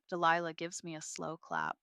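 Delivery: plain reading voice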